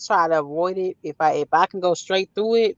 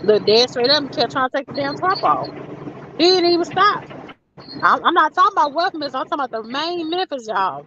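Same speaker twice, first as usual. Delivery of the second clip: high, incredulous and emphatic; can't believe he didn't move; worked up; 9 semitones higher than usual. Real, not acted. Incredulous retelling, speaker worked up.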